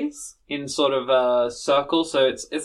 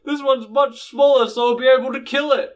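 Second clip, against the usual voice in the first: barbarian; 8 semitones higher than usual. Acted. Boris spots the mouse.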